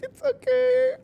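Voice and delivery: very high, loud mock-crying squeak; mock tearful. Smitten fan forgiving the cruel character, in a hammed-up tearful squeal. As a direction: whimper it like a hopelessly smitten fan. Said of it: "And I'd still be like".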